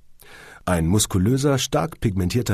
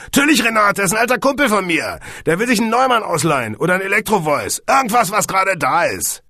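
loud, high, rowdy reassurance; boisterous; 12 semitones higher, 6 dB louder than usual. A narrator's reading. Steven vouching for his old pal to Renate, boisterous. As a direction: rattle it out loudly and breezily.

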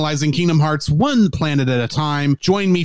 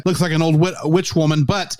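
usual speaking voice

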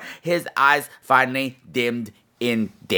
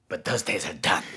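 much lower and softer, menacing; ominous hush; 10 semitones lower, 8 dB quieter than usual. Acted. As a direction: drop the voice low before the revolt. Quiet ominous turn.

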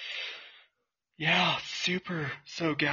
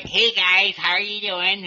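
chipmunk voice; 6 semitones higher, 5 dB louder, slower than usual. Shown as a demonstration. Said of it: "I can sound like a chipmunk".

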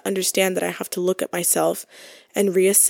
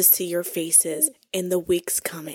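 hushed sign-off; 7 dB quieter, slower than usual. Pretend. Mock formal sign-off.

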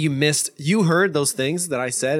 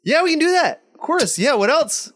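very high, eager-to-please server; overfriendly drive-thru guy; 12 semitones higher, 3 dB louder than usual. Retold or imitated. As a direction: pile on fake customer-service cheer. Imitates overfriendly drive-thru employee.

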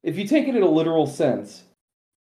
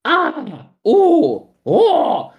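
high mock scream cries of ah and oh; cartoonish identical screams, much higher; imitated skeleton screams; 14 semitones higher, 6 dB louder, much slower than usual. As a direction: yelp three times like the movie skeletons, high and silly. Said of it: "the way they screamed was all the exact same way".

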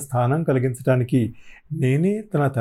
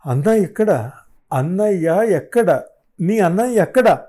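louder and higher with each repeat, pressing her; insistent demand; 6 semitones higher, 4 dB louder than usual. Acted. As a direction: build from firm to a near-shout. విసురుగా దగ్గరికి వెళ్ళాను.

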